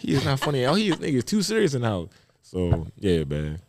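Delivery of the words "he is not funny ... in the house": high, amused mock whisper-talk of his wife; teasing imitation of wife judging him; mimicking his wife. Wife sizing up the crowd, mock amused voice.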